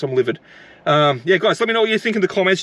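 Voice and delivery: ordinary talk, nothing marked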